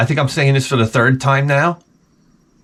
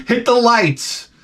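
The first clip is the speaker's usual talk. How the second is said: calling out; 10 semitones higher, slower than usual. Acted. Calls out to close the bit.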